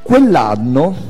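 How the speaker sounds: ordinary talk, nothing marked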